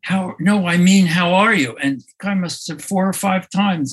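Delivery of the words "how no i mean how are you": higher and louder, hitting "are" in "how are you?"; more insistent, stress on "are". Retold or imitated. Man keeps pressing the sick friend, stress on "are". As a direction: push harder, landing on "are". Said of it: "putting different emphasis on the different words".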